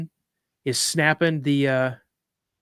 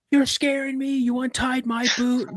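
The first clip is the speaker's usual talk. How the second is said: high-pitched frantic accusation; retold yell, pitched way up; panicked kid yelling; 10 semitones higher than usual. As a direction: imitate a scared child losing it at dad. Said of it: "I'm yelling at my dad".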